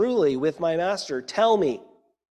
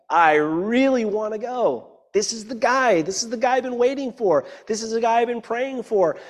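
put-on giddy excited girl; 7 semitones higher than usual. Preacher voicing Rebekah thrilled to marry, gushing high. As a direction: squeal it like a girl who finally found the one. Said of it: "She's like".

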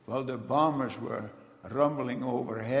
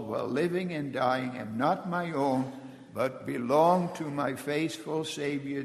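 ordinary talk

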